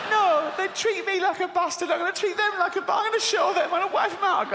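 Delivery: high-pitched petulant God throwing a tantrum; hissy fit. Retold or imitated. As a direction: stamp your foot like a sulky child. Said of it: "having a hissy fit".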